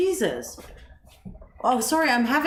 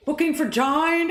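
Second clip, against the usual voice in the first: high Brummie voice, "Jane" said like "Jine"; mock Brummie accent; 7 semitones higher than usual. Caller repeating the booking in a mock Brummie accent. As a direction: imitate a Brummie caller, pitched up. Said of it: "oh, the Brummie accent?".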